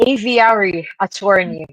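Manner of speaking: ordinary delivery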